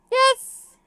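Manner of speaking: laughing, very high squeaked "yes"; exaggerated high pitch. Shown as a demonstration. Reader mocks own accidental high answer, high laughing squeak.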